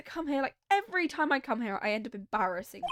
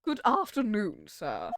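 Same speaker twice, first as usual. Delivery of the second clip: put-on British accent; slower than usual. Bellboy greeting a guest, British accent. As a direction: greet them as a formal British servant. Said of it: "British accent for this guy".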